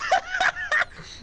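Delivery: squealing laugh; high laugh. Real, not acted. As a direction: crack up.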